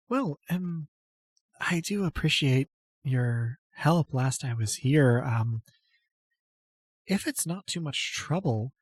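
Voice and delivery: put-on a timid goddess-follower greeting an old friend; timid noble lady. GM voices Apatia.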